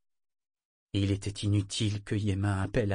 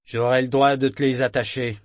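growled; 2 semitones higher, 7 dB louder than usual. A narrator's reading. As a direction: growl it as a threat. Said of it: "gronda-t-il".

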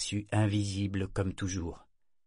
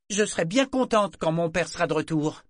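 sulky; 8 semitones higher, 5 dB louder than usual. A narrator's reading. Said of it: "déclara-t-elle d'un ton boudeur".

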